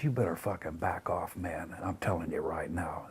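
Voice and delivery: quiet threat. Retold or imitated. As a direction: threaten him under your breath. Retold warning to back off.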